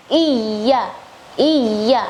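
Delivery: "iya" with a held, doubled y. Shown as a demonstration. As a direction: press the y in "iya", tasydid. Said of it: "seharusnya iya tasydid". ("iya" dengan y ditasydid, ditekan)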